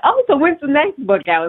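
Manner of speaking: lightly mimicked nosy well-wisher, pitched high; eager asker. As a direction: imitate people eagerly asking, voice lifted. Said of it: "then people were like".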